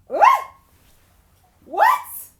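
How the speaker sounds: very high, loud outburst; shocked shriek. Acted. Reader appalled by the quiz's turn, shrieking. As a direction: shriek it in disbelief.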